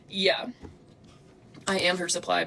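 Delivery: ordinary talk, nothing marked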